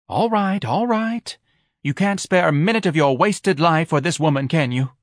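high, exasperated, sarcastic; exasperated wife. A narrator's reading. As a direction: throw up your hands in exasperation.

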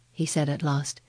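ordinary delivery, nothing marked

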